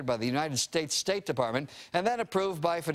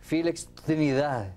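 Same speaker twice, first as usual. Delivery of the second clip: Felix Trinidad with a Spanish TV rolled flourish; Spanish broadcaster accent. Retold or imitated. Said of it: "as they would say on Channel fifty-four".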